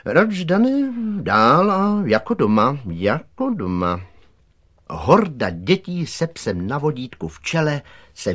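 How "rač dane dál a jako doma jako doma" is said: ceremonious welcome. A narrator's reading. Director invites Dan the dog inside, with ceremonious welcome. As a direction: usher the guest in, easing down at the end.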